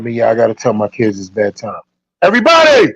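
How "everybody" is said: loud, high barked call to the whole house; drill sergeant voice. Real, not acted. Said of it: "Drill sergeant voice".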